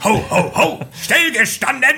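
high, hammy military Santa bark; barked drill commands. Retold or imitated. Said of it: "es war ein Militärweihnachtsmann".

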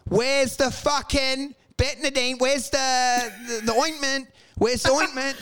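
high, slow frantic pleading; mock desperate plea. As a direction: beg for the ointment in a panic. Frantic search for ointment.

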